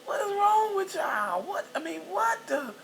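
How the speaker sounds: high, loud disbelief, drawn out; incredulous shout. Retold or imitated. Mother shouting at the couple in disbelief, high and loud. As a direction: shout in shock at them. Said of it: "I was like".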